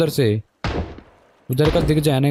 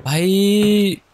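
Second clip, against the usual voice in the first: dismayed cry; 6 semitones higher, much slower than usual. Real, not acted. Gets hit, dismayed cry.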